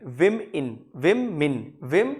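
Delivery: विम and इन as two separate beats; syllable by syllable. Shown as a demonstration. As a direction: break it into विम, then मिन. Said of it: "पहला विम आता है और मिन आता है".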